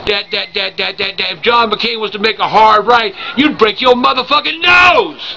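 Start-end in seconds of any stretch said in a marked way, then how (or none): 3.4-5.4 drawn-out, higher-pitched shout; slow down and shout the punchline; ranting shout. Pretend. Grandpa blasting Sean Hannity for going too far right, ranting shout. Climax of political rant.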